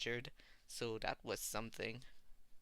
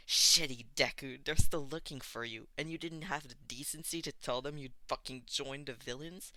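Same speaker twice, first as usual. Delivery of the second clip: snarling; 8 dB louder than usual. A narrator's reading. Bakugo snarling at Izuku, furious. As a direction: bite every word at him. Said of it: "Bakugo snarled".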